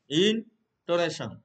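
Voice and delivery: ইনটোনেশন said slowly, each syllable spelled out for students; slow clear term. Shown as a demonstration. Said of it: "teacher repeats the term slowly". (ইনটোনেশন ধীরে ধীরে, প্রতিটি অক্ষর স্পষ্ট করে)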